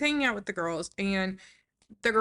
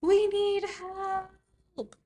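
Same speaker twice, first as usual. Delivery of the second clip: slow, very high plea; high pleading Joy; 12 semitones higher, much slower than usual. Retold or imitated. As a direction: draw it out high and helpless. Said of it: "And Joy is like".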